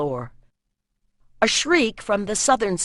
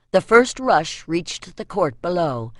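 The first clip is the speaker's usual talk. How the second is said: plain reading voice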